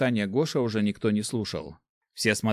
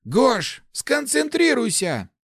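high, loud, drawn-out order; high-voiced, urgent scolding; exasperated urging; 14 semitones higher, 5 dB louder, slower than usual. A narrator's reading. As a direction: plead sharply for focus. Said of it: "зашипел Ларс".

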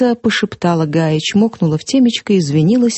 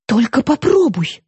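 sharp warning, pitched up; menacing threat; threatening; 6 semitones higher than usual. A narrator's reading. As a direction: dare her to try it. Astra threatens sister.

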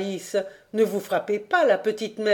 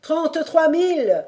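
high, loud yell to the quay; shouted across water; 9 semitones higher, 5 dB louder than usual. Acted. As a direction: bellow the number out over the harbour. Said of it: "hurla lorsqu'il fut à distance".

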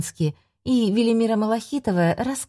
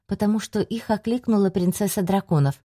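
plain reading voice

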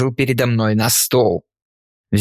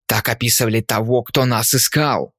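ordinary delivery

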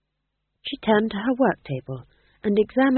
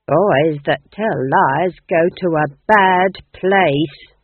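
slow, stern nanny warning, louder; 9 dB louder, much slower than usual. Acted. Nanny Ogg warning the ghost king about lies, slow and stern. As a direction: lay it down like a stern nursemaid. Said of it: "warned Nanny".

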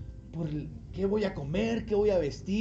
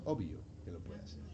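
low, soft, quick mutter; offhand murmur; throwaway aside; 14 dB quieter, faster than usual. Real, not acted. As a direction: toss it off as obvious.

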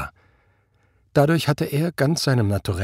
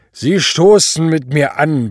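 loud, slow, raised order; tipsy command; 6 semitones higher, 8 dB louder than usual. A narrator's reading. Drunken superior orders his officer to join a toast, commanding. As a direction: order it slowly and loudly, drink in you. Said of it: "befahl der Obergruppenführer".

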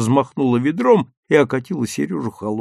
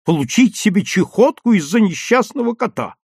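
high, loud indignant voice; shrieky overheard family quarrel; irritated shouting; 9 semitones higher, 5 dB louder than usual. A narrator's reading. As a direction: complain loudly in exasperation. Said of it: "раздражённо кричала Исфирь Яковлевна".

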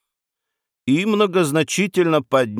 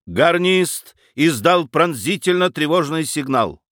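ordinary delivery, nothing marked

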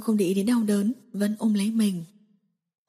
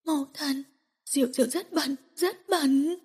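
tearful whimper; 4 semitones higher, 4 dB quieter than usual. Acted. Broken woman sobbing.

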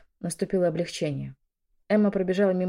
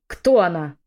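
loud, angry bark; barked demand; 9 dB louder, slower than usual. A narrator's reading. Woman grips the fleeing maid, barked demand. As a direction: snap the question out forcefully. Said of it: "рявкнула я".

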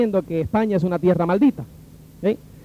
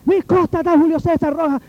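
high, urgent roll-call shout; calling out names; 8 semitones higher than usual. Retold or imitated. Officer runs up looking for detainees, calling out names. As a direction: call out as if just running in.

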